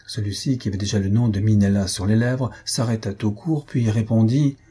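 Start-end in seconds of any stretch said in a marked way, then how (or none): none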